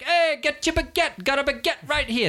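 high-pitched street vendor cry; baguette seller hawking. Acted. Baguette monger to passing crowd, high hawker call. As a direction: call out bread like a market hawker. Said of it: "other people are like".

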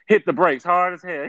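ordinary talk, nothing marked

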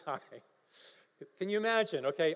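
usual speaking voice

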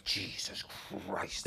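hushed oath under the breath; low, breath-only curse; awed dread. Acted. Co-driver to himself as the rally car launches, hushed dread. Scared reaction at the start, retold.

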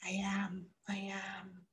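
hushed whisper; soft secret whisper. Retold or imitated. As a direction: breathe it out, no voice. Whispered reply to therapist.

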